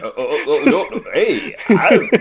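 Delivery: high, stammering mock panic; flustered backpedalling. Co-host jokingly voicing the man dodging marriage, flustered.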